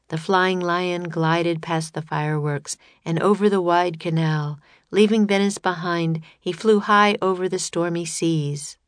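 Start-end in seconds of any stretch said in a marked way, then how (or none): none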